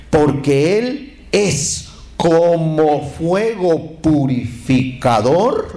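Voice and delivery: slow, drawn-out, emphatic recitation; solemn scripture reading. Retold or imitated. Preacher reading Malachi to the congregation, slow and emphatic. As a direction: stretch each word of the verse. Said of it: "mire lo que dice".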